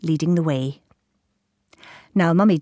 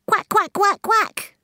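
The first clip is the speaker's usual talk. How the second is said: high, loud duck quacks; mummy duck quacking; 4 dB louder, slower than usual. A narrator's reading. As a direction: quack like a mother duck calling her young. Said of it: "took a deep breath and said".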